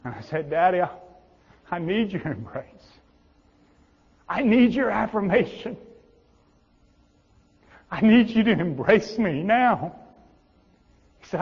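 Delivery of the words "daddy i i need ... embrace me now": pleading cry. Retold or imitated. Pleading to God the father.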